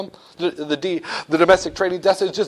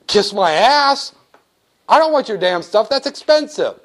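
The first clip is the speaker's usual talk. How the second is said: mock indignation; 5 semitones higher, 3 dB louder than usual. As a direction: brush off the offer in mock outrage. Banker refuses the price.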